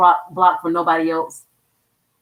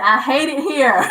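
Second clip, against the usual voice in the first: mock complaint; 6 semitones higher than usual. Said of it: "How somebody said".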